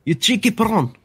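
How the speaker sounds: high-pitched mock South African accent; playful South African accent jab; South African accent attempt. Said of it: "I can't do a South African accent".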